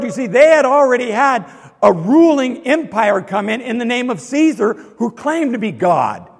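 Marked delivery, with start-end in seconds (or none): none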